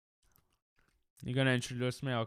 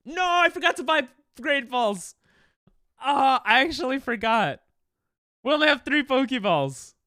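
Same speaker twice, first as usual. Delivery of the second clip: loud, high, exasperated outburst; frustrated shout; 10 semitones higher, 8 dB louder than usual. Real, not acted. Streamer realising mid-battle there are no Great Balls, loud frustrated shout. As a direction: yell it at yourself for forgetting.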